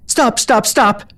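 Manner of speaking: high, desperate cries of pain; crying out. A narrator's reading. Strapped mare crying out as rescuer pulls strap, desperate. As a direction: cry out to make it stop. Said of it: "she cried".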